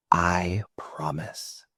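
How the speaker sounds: whispered slowly, dropping to a hush; soft whisper. Shown as a demonstration. Guide eases the group into a whisper. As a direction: let the promise fade to a breath. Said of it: "to soft".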